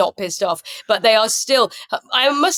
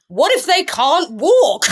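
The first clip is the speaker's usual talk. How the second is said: pitched high and loud, drawn out; slow, raised-voice pitch of a silly idea; mock brainwave; 7 semitones higher, 4 dB louder, slower than usual. Comic mocking the show's writers, mock brainwave.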